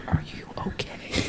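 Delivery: hushed whisper, as during prayer. Retold or imitated. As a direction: whisper it so the prayer goes on undisturbed. Churchgoers asking during group prayer.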